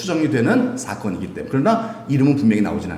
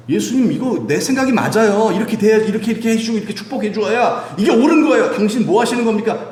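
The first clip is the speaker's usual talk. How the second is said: much higher, louder insisting voice; demanding complaint; 10 semitones higher, 4 dB louder than usual. Acted. Believer insisting to Jesus their way is right, much higher. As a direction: demand your own way from God. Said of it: "이렇게 생각할 수도 있잖아요".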